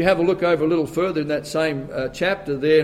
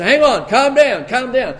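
calm down; 6 semitones higher, 5 dB louder, slower than usual. Retold or imitated. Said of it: "they starting to get all excited and jumping up and down".

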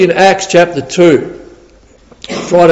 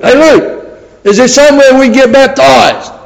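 calling out; 8 semitones higher than usual. Retold or imitated. Inmate calling to prison officer.

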